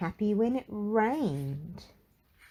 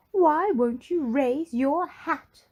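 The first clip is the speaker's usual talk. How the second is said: high, louder, slow prim demand; Little Miss Splendid demanding; 9 semitones higher, 5 dB louder, slower than usual. Acted. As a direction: press him slowly and firmly.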